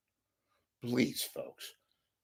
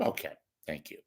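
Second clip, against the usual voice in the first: ordinary delivery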